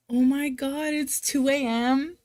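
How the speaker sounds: astonished and concerned; shocked. Real, not acted. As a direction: exclaim in amazement, stretching the end. Shocked at viewer's late hour.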